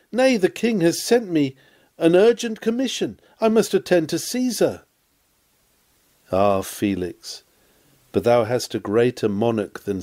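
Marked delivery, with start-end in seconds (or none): 0.1-4.8 a higher, mock-important voice for Felix's excuse. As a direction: play Felix brushing the preacher off. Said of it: "Dost thou reply".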